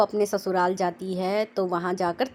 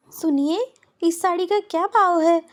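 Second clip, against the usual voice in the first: put-on higher, louder girlish ask; young girl's voice; 10 semitones higher, 6 dB louder than usual. A narrator's reading. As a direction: call out to the shopkeeper brightly. Daughter asks shopkeeper.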